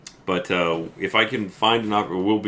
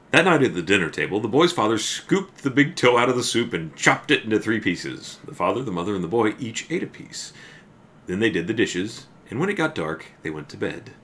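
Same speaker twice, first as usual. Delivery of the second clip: spooky storybook reading for the stream; story narration; 4 semitones higher, 4 dB louder than usual. A narrator's reading. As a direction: read it like a campfire tale. Reads the story aloud.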